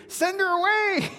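high, loud mock yell; hammed-up disciples brushing the woman off. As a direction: shout it up high like a fed-up disciple. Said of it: "They're quick to advise Jesus what to do".

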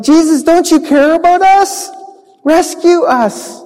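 high, desperate plea; scared disciples begging Jesus for help; pleading. Acted. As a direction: beg to be rescued.

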